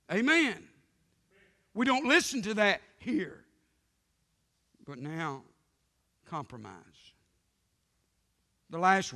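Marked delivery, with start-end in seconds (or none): 0.1-3.4 higher and louder declaration; full-force pulpit cry; preaching shout. Real, not acted. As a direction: ring it out to the pews.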